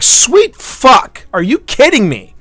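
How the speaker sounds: a burst of stunned disbelief; shocked disbelief. Retold or imitated. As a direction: blurt it out, stunned by the news. Shocked at the vet's diagnosis.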